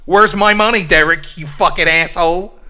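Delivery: high, loud mock outburst; acted streamer losing his temper; DSP. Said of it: "I think I might be losing it".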